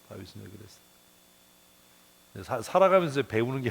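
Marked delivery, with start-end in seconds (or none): 0.1-0.9 much softer, almost to himself; soft echo. Real, not acted. Preacher repeating the question to congregation, hushed and reflective. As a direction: let the question fall away quietly. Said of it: "repeats the question softly".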